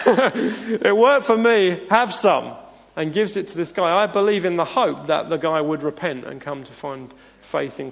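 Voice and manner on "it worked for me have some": slow, higher, laughing through the words; laughing Paul. Retold or imitated. Pastor redoing Paul's offer to the congregation, slow and laughing. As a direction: savour the joke as you say it. Same quote redone laughing.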